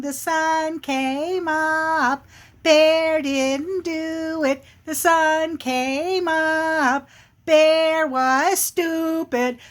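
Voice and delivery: high, very slow singsong chant; relentless smart-alecky squirrel taunt. Acted. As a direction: gloat in a drawn-out playground singsong. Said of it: "that little squirrel just would not stop".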